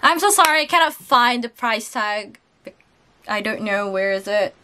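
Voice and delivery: ordinary talk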